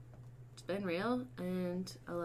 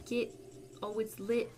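normal talking voice

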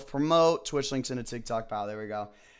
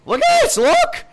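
screamed far higher and much louder in real rage; furious yelling; 13 semitones higher, 13 dB louder than usual. Real, not acted. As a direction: vent at the stupid cup at full volume. Raging at a bouncing cup.

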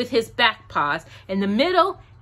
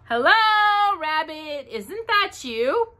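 high, gentle bear voice; high Pooh voice calling into the hole; 8 semitones higher, slower than usual. A narrator's reading.

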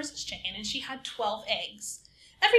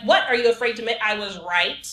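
challenging tone; 11 dB louder than usual. Acted. Chicken challenges Rooster.

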